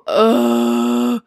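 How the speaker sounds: ordinary delivery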